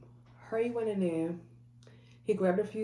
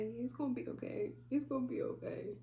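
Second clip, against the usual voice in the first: teary high reply; 7 semitones higher, 7 dB quieter than usual. Retold or imitated. Both crying in parking lot.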